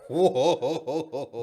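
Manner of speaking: loud, laughing whoa of disbelief. Retold or imitated. Retelling his shock at the driver's voice, laughing whoa. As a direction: blurt it out through a laugh.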